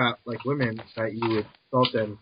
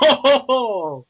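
loud, high crowd-style "ohhh"; jeering "oh"; 10 semitones higher, 9 dB louder than usual. Real, not acted. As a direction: hoot at someone else's burn. Heckler reacts to teasing jab.

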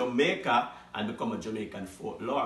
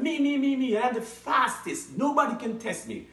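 boastful cat; 8 semitones higher than usual. Acted.

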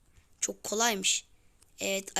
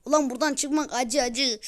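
ordinary talk

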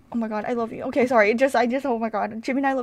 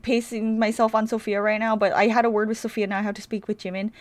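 ordinary delivery

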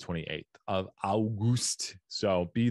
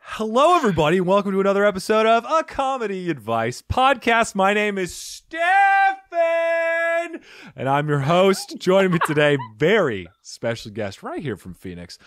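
louder, higher announcer welcome; podcast host intro; 11 semitones higher, 10 dB louder than usual. Acted. Host welcoming listeners to the comedy podcast, announcer style. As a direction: open the show like a hyped radio host. Formal show opening.

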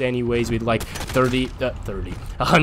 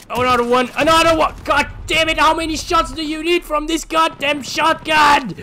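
higher and louder, exasperated; raging gamer; 12 semitones higher, 4 dB louder than usual. Real, not acted. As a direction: rant at the shotgun.

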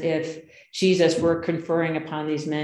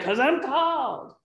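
much higher, slowed, drawn-out called; emphatic retort; 10 semitones higher than usual. Retold or imitated. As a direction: proclaim the calling with a mock flourish. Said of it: "You know what he said, right?".

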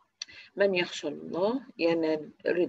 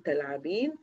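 usual speaking voice

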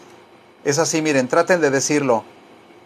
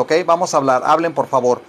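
ordinary talk, nothing marked

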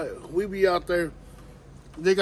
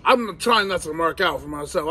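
usual speaking voice

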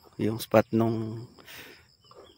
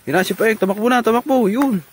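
high, loud delight as he runs; excited cheer; 12 semitones higher, 7 dB louder than usual. Real, not acted. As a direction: cheer him on. Cheering the carabao running.

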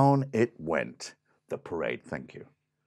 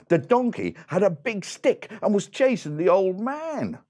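plain reading voice

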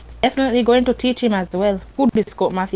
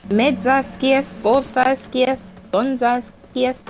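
slow lament; much slower than usual. Pretend.

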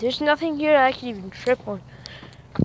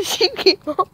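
nervous laughter; 7 semitones higher than usual. Real, not acted. Nervous laugh after scare.

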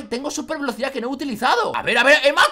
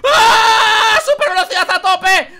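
loud, high frantic yelling; excited shouting; 5 semitones higher, 7 dB louder, much slower than usual. Real, not acted. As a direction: yell while fleeing in a panic. Escapes with super speed.

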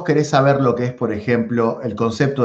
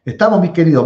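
ordinary talk, nothing marked

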